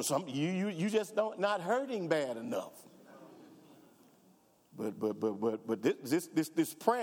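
ordinary delivery

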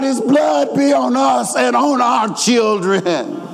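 high, drawn-out crowd cry. Retold or imitated. Crowd answering Pilate about Jesus' blood, crying out. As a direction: imitate a mob calling out, slowly and high. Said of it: "And they cried out".

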